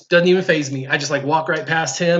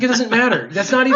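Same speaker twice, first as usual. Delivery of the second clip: higher-pitched motherly brush-off; dismissive mom; 6 semitones higher, 3 dB louder than usual. Retold or imitated. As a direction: imitate mom waving away the problem. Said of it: "And she's like".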